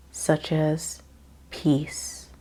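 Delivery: normal talking voice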